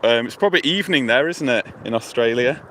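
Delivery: normal talking voice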